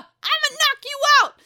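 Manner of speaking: high, loud mock-fighting holler; hammed-up shout; playful shouted threat. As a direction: belt out a pretend threat.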